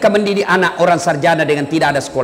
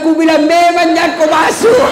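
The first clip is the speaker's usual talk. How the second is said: mock told-you-so shout; 8 semitones higher, 4 dB louder than usual.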